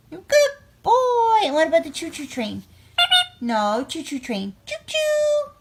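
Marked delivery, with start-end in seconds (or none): 0.1-1.4 high, loud praise for the dog; excited praise. Real, not acted. Owner praising dog for a trick, loud and excited. 4.6-5.5 high, whistle-like choo-choo; train whistle. Pretend. Owner making train noise for the dog, high and playful.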